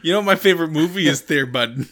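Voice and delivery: put-on joke Wisconsin accent. Host quoting co-host's movie joke, joke Wisconsin accent. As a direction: set up a gag in Wisconsin talk. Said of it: "You're like".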